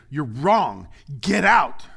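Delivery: loud rebuke building to a shout; stern rebuke. Acted. Jesus rebuking his disciples, sharp and loud. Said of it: "he turned and he rebuked them".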